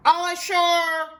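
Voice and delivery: high, loud shout of sailors; men shouting. A narrator's reading. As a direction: call it out like deckhands. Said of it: "the men shouted".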